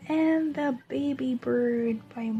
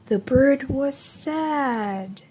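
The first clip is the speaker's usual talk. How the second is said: put-on gentle picture-book voice for young listeners; storybook reading; 5 dB louder than usual. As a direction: read it like story time in class. Reads a picture book to children.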